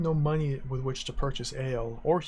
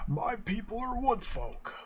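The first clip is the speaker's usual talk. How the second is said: higher, odd gnome voice, then slow; boozy gnome; 4 semitones higher, 3 dB quieter, slower than usual. Acted. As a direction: voice a tipsy gnome. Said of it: "The boozy gnome".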